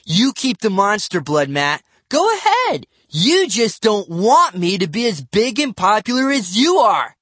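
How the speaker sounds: put-on loud, high bitter kid; resentful outburst. A narrator's reading. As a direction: spit out jealousy.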